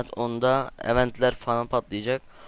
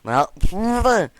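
high, loud indignant "what did you do"; outraged shout; 9 semitones higher, 9 dB louder than usual. Real, not acted. Gamer shouts in outrage at a player who hit him. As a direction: shout it at the screen in disbelief.

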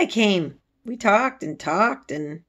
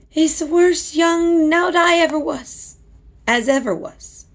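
exasperated; 10 semitones higher, 5 dB louder than usual. A narrator's reading. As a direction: drag out her disgust. Martha scolding about spoiled boy.

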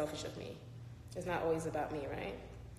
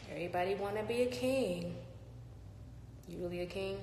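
normal talking voice

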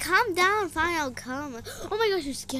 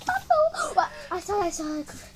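normal talking voice

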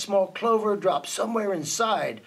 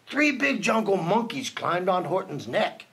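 ordinary delivery, nothing marked

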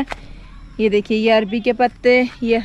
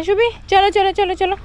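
high sing-song coaxing; urging on; 8 semitones higher than usual. Real, not acted. Mother coaxing toddler Shubhi along the path, high sing-song. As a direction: cheer the little one along.

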